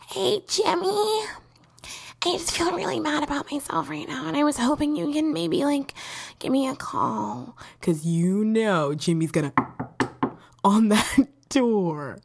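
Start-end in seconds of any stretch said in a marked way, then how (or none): 0.1-7.6 fish for sympathy in a high mopey whine; mopey needy caller. Pretend. Lonely girl calling her ex-crush for comfort, high whiny. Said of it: "You wanna call up Jimmy".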